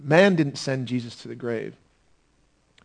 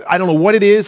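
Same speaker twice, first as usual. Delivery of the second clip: raised, louder and higher pleading; wife's urgent plea; 6 semitones higher, 6 dB louder than usual. Retold or imitated. Wife begging Pilate to spare Jesus, urgent raised plea. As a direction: beg him as his alarmed wife. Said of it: "She says".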